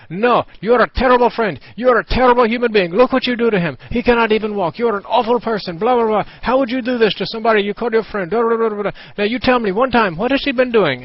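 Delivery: high, loud, mocking tirade from an outraged mother; furious scolding mom. Imitating the mother yelling at him for being a terrible friend. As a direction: rant at him like a hysterical parent.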